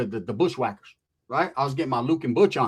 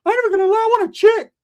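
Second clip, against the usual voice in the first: much higher, loud squeaky cousin voice; Rick's squeaky voice; 10 dB louder than usual. Retold or imitated. Cousin Rick answering, high loud squeaky voice. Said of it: "I said".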